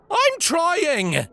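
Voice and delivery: high hollered reply. Acted. Patrick hollers back to his mother from upstairs. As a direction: holler it back from another room. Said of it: "Patrick's voice hollered back".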